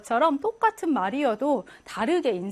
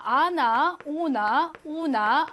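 slow vowel demo; 3 semitones higher, much slower than usual. Shown as a demonstration. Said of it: "어떻게 발음하냐인데요".

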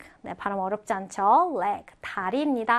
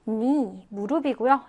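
ordinary talk

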